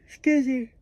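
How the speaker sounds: words stretched through a loud yawn; real yawn. Real, not acted. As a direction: yawn right through the apology. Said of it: "I'm so sorry that I'm yawning".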